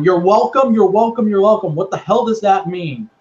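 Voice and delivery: hammed-up indignant outburst; mock angry yell. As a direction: rattle it off in mock exasperation. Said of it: "[name]'s yelling again".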